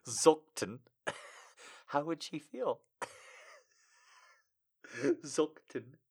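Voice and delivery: giggling through the name; laughing. Real, not acted. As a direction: crack up at the typo. Laughing at misspelling.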